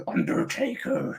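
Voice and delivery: a breathy, high whispered Undertaker name. Pretend. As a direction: hiss the name like a creepy villain. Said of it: "naming a voice box character".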